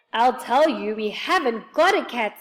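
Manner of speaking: loud, emphatic exasperation; quite cross. A narrator's reading. Joe to the man who keeps mishearing, loud and cross. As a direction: insist crossly, hitting the words hard. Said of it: "feeling quite cross".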